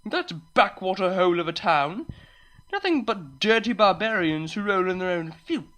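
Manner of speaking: haughty, contemptuous put-down; snobbish disgust. Acted. Unicorn insulting the farmer's hometown, disgusted. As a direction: sneer it with disgust. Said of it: "spat the unicorn in disgust".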